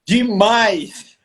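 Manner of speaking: real amused emphatic admission; laughing exclamation. Real, not acted. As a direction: blurt it out emphatically, then laugh. Jokingly admits he was very shy.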